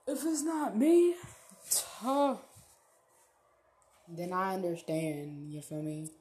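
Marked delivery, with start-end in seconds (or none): 0.1-2.4 higher, louder taunt; loud challenge. Real, not acted. Daring viewer about the money, loud taunt. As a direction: call them out loud.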